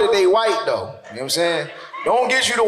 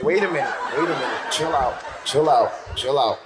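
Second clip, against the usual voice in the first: cop telling her to chill; 7 semitones lower than usual. Retold or imitated.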